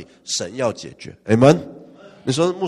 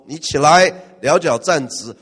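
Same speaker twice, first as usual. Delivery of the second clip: booming call to rise and stand; Paul loudly ordering the lame man up; loud command; 3 semitones higher, 9 dB louder than usual. Acted. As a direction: command him to his feet, loud.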